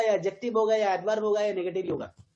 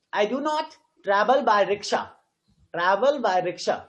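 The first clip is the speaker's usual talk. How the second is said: slow reading, stressing "not", "travel" and "rickshaw"; teacher's slow demo with weight on "not", "travel", "rickshaw"; stressed content words; much slower than usual. Shown as a demonstration. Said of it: "Not is being stressed here".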